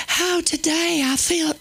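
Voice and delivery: high, hammed-up Southern woman; Southern accent. Comic mocking the Proverbs woman with a Southern accent. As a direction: ham up a Southern belle. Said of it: "She probably talked more like".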